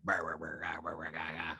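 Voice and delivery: low, garbled mumbling. Retold or imitated. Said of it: "he definitely has a deep ass voice".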